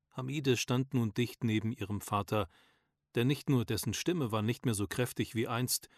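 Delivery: ordinary delivery, nothing marked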